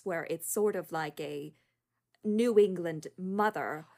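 slow, mannered New England accent; Kate Winslet American accent. Shown as a demonstration. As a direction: impersonate an actor's drawn-out New England accent. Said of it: "Kate Winslet talks like this".

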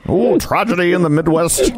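put-on old-timey Mid-Atlantic news announcer; Mid-Atlantic newsreader. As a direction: read it like a 1940s Mid-Atlantic newscaster. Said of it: "That news announcer with the Mid-Atlantic accent".

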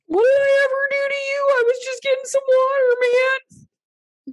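very high, loud aggrieved ghost; seething shrieking spirit. Pretend. Voices the trampled woman.

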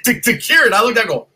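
ordinary talk, nothing marked

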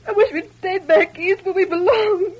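words breaking into sobs; crying. Acted. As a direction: cry out your homesickness. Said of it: "please, don't cry".

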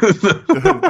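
loud laughing burst; burst of laughter. Real, not acted. Laughing at "Dungle" slip.

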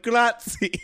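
high laughing grazie; laughing thanks. Retold or imitated. Thanking the lady at the counter while laughing. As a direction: blurts thanks through a laugh. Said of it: "I'm like".

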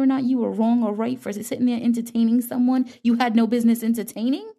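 fast, heated scolding; indignant rant. Real, not acted. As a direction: dress them down in disbelief.

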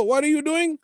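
high imitation Indian accent; Raj's Indian accent. Telling a story about his man, high Indian-accented impression. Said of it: "in that exact same motherfucking voice".

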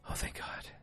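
breathless whispered relief; relieved whisper. Retold or imitated. Peggy, relieved she isn't fired, whispering to herself. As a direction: let it out under your breath.

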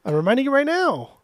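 high-pitched exasperated retort. Real, not acted. Co-host answers friend who keeps forgetting, exasperated. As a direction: throw it back at them, pitch jumping way up.

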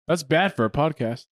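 ordinary talk

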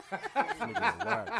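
drawn-out mock disgust. Mock disgust, slowed and laughing.

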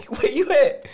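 searching the house for Bubba, calling out. Retold or imitated. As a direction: call out for a brother hiding in the house. Calling for brother again.

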